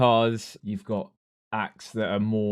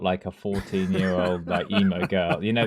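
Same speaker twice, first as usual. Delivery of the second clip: ordinary talk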